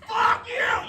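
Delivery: very high-pitched screamed curse; acted scream. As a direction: scream it at the driver ahead. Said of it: "just scream".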